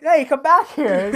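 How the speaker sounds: high, loud and slow call. Retold or imitated. Retelling the villain's line, a high loud call. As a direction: shout after them to come back. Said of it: "just like".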